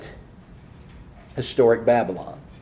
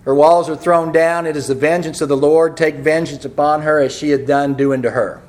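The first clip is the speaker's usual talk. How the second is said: loud, higher prophetic declamation; forceful scripture reading; 4 semitones higher, 6 dB louder than usual. Real, not acted. Preacher reading the vengeance of the Lord aloud, forceful proclamation.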